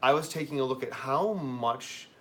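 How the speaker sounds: ordinary talk, nothing marked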